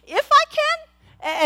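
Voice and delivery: slow mock-astonished echo; Jesus, incredulous. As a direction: stretch it like you can't believe he asked. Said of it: "the tone of voice that he used".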